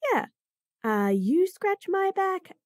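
ordinary delivery

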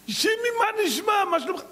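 high friendly hello; cheery greeting. Acted. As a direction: greet him brightly at the door. Yossi greets Shimi.

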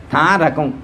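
normal talking voice

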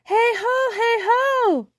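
high, loud hail repeated; calling out. A narrator's reading. Birdling calling after the fairy ships, high and loud. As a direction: keep hailing them across the sea.

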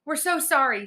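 high, timid little apology; high-pitched and cowed; frightened apology. A narrator's reading. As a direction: apologize meekly to a monster. Frightened Alex apologizes to troll.

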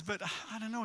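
put-on American accent. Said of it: "he said in American".

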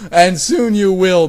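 loud, slow, high proclamation; menacing declaration. A narrator's reading. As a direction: draw out the threat at full volume. Villain line cut short in story.

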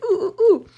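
high whimpering ooh sounds; excited squeal. Real, not acted. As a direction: squeak with giddy suspense. Poll nearly decided.

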